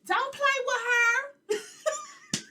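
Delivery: high, slow, loud laughing warning; cashier retold. Cashier warning the coworker, slow, high and laughing. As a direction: drag it out laughing like a sassy cashier. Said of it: "she was like".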